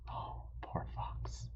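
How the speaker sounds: soft whispered sympathy; whispered pity. Real, not acted. Teacher pities the trapped fox, whispered. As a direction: breathe it out like a secret.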